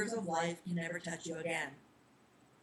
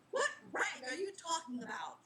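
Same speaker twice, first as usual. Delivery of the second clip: mild yell; 8 semitones higher than usual. Acted. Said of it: "Charlie yelled".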